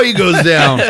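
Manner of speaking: ordinary talk, nothing marked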